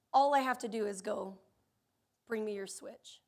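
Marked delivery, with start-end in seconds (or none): 2.3-3.2 soft, low, no need to yell; quiet threat. Retold or imitated. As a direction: drop the voice, calm and final. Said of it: "I don't even have to yell".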